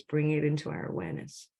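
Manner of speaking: ordinary talk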